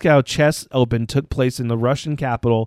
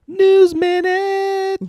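drawn-out jingle call, high; segment announcer; 3 dB louder, much slower than usual. Acted. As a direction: sing out the segment title.

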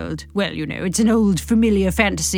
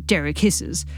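ordinary delivery, nothing marked

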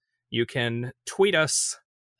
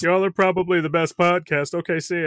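raised, louder fake caller voice; disguised voice; 4 semitones higher, 4 dB louder than usual. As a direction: pretend to be a stranger calling in. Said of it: "in a disguised voice".

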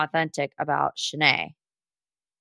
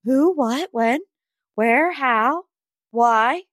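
hammed-up rapid-fire questioning, each word separate; mock shocked questioning, higher pitched; baffled fans; 3 semitones higher, much slower than usual. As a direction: imitate bewildered fans, each question rising. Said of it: "everyone was like".

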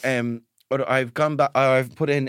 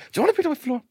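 much higher, building the question; high mock mom telling off; 12 semitones higher, much faster than usual. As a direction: drag it out to tease the kids. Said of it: "George said".

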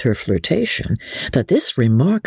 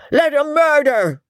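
mocked statesman, pitched much higher; mimicking Pitt; 14 semitones higher than usual. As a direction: ham up a politician's callous verdict. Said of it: "says Mister Pitt".

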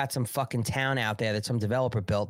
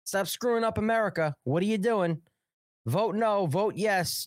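yelling at a congressman down the phone, pitched way up; mock angry phone call; 7 semitones higher than usual. Caller chewing out members of Congress, pitched-up mock fury. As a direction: berate him like you've got him on the line. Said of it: "power call members of Congress and say".

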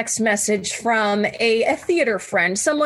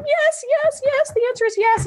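much higher, quick and excited; eager. Retold or imitated. Couple accepting adoption offer, high and eager. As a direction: gush out the yes.

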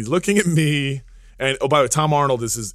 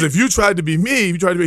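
higher, louder, lightly imitated challenge; Arnold Schwarzenegger; 4 semitones higher, 6 dB louder than usual. Retelling a star daring him to compare, loud light imitation. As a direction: push it out as a mock challenge. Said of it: "Arnold always wins".

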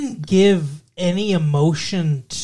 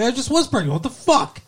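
indignant disbelief; 8 semitones higher than usual. Retold or imitated. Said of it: "And she's like".

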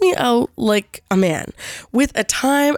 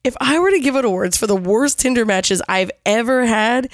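normal talking voice

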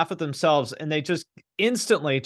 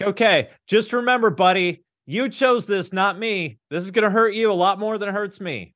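mock menace; 6 semitones higher, 3 dB louder than usual. Mock threat to the crowd.

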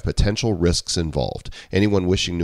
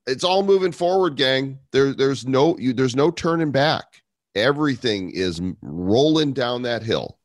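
excited; 7 semitones higher than usual. Acted. Excited hype to audience.

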